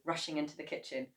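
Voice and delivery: plain reading voice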